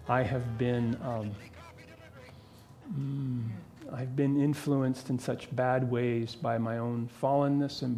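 soft, low confessional prayer to God; a pastor's gentle closing prayer; quiet prayer. Acted. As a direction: pray it humbly, hushed and unhurried. Leads the congregation in prayer.

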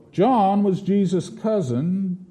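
usual speaking voice

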